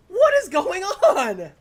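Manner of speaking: high slow disbelieving shout; excited shouting. Real, not acted. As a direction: yell it in disbelief.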